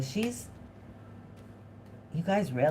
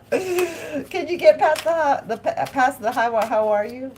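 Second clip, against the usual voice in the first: louder, higher, slowed words through laughter; laughing shout; 8 semitones higher, 6 dB louder, slower than usual. Real, not acted. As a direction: push the words out while still laughing.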